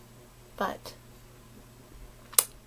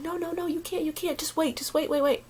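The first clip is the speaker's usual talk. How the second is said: panicked pleading; 5 semitones higher, 3 dB quieter than usual. Retold or imitated. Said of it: "I'm panicking".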